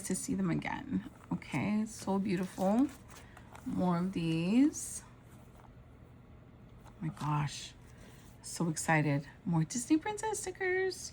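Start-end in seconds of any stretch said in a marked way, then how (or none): none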